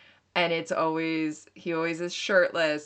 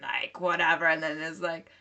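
usual speaking voice

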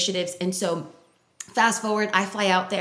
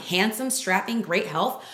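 ordinary talk

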